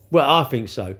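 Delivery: ordinary delivery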